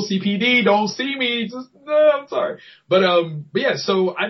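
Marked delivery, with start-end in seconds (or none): 0.0-2.6 mock plea. Real, not acted. Joking plea to the police.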